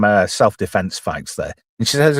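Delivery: normal talking voice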